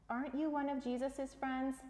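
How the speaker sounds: high, quizzing stranger; stranger by the fire. Acted. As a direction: pitch it well up, prying. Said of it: "someone asked Peter".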